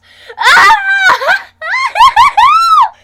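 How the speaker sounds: shrieking laugh-scream, very high and loud. Acted. Rider on the plunging coaster, shrieking laugh-scream. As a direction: let out a wild scream of laughter.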